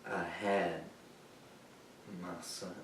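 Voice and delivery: very soft and low, broken by a long pause; grief-stricken. Acted. Veteran remembering his son, hushed and grieving. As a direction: let it sink away to almost nothing.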